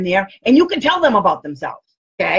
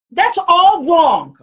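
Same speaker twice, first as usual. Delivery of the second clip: slow, higher, louder insistence; retold correction; 6 semitones higher, 4 dB louder, much slower than usual. Correcting the caller, slow emphatic objection. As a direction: drag it out, telling her she's wrong. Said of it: "I told her".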